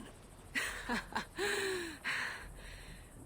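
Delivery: a breathy, airy mocking laugh; scornful laugh. Asa laughing off the Aesir's threat, a breathy scornful laugh.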